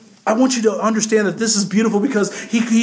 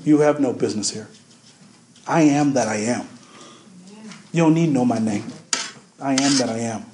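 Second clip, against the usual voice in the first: low, measured voice of divine authority; God's rebuff; 9 semitones lower, 3 dB quieter than usual. Acted. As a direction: close the matter with low, firm finality. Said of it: "That's what that answer is".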